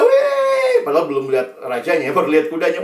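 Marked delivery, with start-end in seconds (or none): 0.0-0.8 high, loud whoop; euphoric cheer. Acted. Schoolchildren spot the king's horses, euphoric cheer. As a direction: whoop like a child sighting royalty. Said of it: "euforianya luar biasa".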